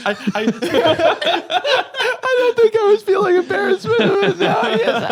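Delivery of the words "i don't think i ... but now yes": talking through loud, high laughter; laughing. Real, not acted. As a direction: crack up while getting the words out. Cracking up at friends' teasing.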